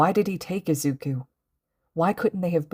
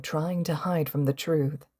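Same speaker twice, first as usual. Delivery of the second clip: usual speaking voice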